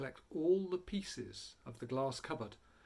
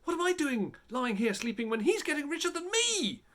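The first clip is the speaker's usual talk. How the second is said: much higher, fretful; first robber waking up, higher and fretful; anxious greedy thought; 10 semitones higher, 6 dB louder than usual. A narrator's reading. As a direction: fret that others are gaining.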